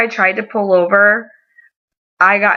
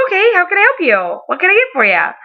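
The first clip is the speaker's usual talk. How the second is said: high, perky mock service greeting; customer-service voice; 11 semitones higher than usual. Bakery worker to walk-in customer, put-on customer-service voice. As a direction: jump up high, eager to help.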